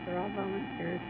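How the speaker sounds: ordinary delivery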